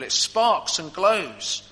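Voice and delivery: lingering on the image; slow and savoured. Real, not acted. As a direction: stretch each word to let it glow. Echoing the sparking image.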